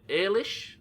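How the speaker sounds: "Ailish" tried out as a rising question; Ailish guess. Shown as a demonstration. Guessing a viewer's name aloud, "Ailish?" rising. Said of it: "Is that how you say it".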